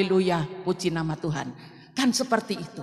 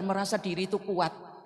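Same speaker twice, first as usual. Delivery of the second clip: usual speaking voice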